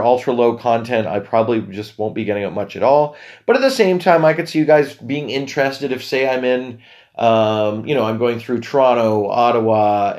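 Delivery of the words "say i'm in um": ordinary talk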